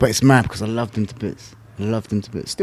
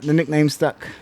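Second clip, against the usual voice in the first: usual speaking voice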